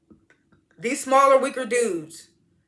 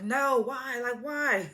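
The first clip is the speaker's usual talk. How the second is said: freaking out; 6 semitones higher, much slower than usual. Retold or imitated. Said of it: "Zane started freaking out".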